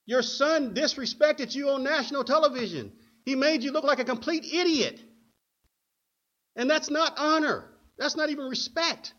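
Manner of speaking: high, loud preacher's scolding; indignant rebuke. Acted. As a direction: admonish forcefully, as if confronting the mother. Preacher rebukes the mother rhetorically.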